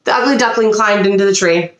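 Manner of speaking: usual speaking voice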